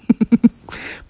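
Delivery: short loud laugh. Real, not acted. Mocking her fake work excuse, loud laugh.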